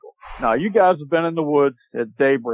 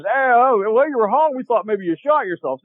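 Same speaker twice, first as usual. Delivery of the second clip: rescuer's quip; 8 semitones higher than usual. Retold or imitated. Rescuer voiced in story.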